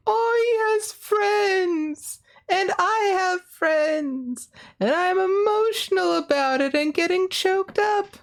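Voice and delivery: high, slow, half-mock teary voice; choked-up inner voice. Streamer imitates her own teary reaction to cheering fans. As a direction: lightly ham up getting choked up. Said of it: "so it's just like".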